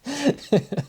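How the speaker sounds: high, helpless giggle; laughing fit. Real, not acted. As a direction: burst out giggling uncontrollably. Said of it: "that one made me laugh".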